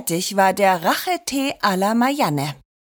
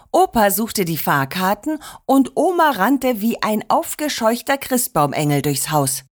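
ordinary delivery

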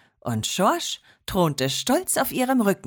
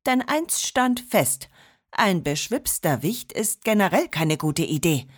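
ordinary delivery, nothing marked

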